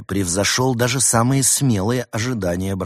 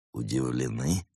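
low, soft smirking question; a quiet smirk in a lowered voice; 7 semitones lower, 6 dB quieter than usual. A narrator's reading. Snow leopard taunting the fox on the phone, smirking. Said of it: "усмехнулся брат Немрод".

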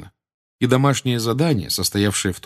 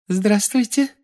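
high, sweet schoolmistress voice; sugary Umbridge voice; 13 semitones higher, slower than usual. A narrator's reading. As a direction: greet the class with false sweetness. Umbridge greets class, high far.

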